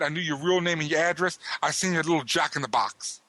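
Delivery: usual speaking voice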